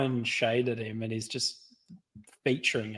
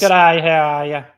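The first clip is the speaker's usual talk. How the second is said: loud, higher-pitched mock Aussie greeting; true Australian accent; 4 semitones higher, 7 dB louder than usual. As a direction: imitate a full-on Australian accent. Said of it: "Some of the accents, I love that".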